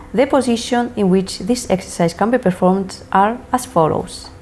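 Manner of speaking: ordinary talk, nothing marked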